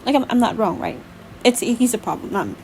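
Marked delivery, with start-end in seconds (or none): none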